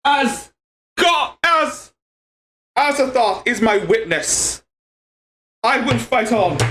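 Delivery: lightly done Irish or Scottish brogue, pitched high; a high, hammy Celtic accent as a dramatic vow; mock Irish-Scottish accent. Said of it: "jumped onto an Irish accent or Scottish".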